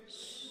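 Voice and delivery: very soft, hushed "shh"; soothing shush. Acted. Interviewer calming an upset applicant, soothing shush. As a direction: gently shush someone upset.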